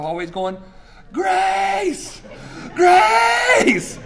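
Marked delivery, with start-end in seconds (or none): 1.1-4.0 put-on very high, loud child's scream. Son running through the hallways, screaming in play-acted alarm. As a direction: yell it out, pitch sky-high. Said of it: "running through the house screaming".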